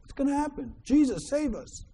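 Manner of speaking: mock panic in a high-pitched voice; freaking out. Fishermen begging Jesus in a storm, freaking out. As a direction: plead in a high, panicky voice without shouting. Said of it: "they're freaking out".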